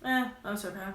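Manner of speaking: bored passenger brushing off the driver's greeting; uninterested. Pretend. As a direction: make clear you don't want to chat. Role-playing an uninterested passenger.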